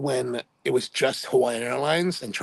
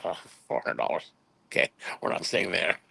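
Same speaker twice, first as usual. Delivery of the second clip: deflated aside; 7 semitones lower, 5 dB quieter than usual. Retold or imitated. Balks at the hotel price.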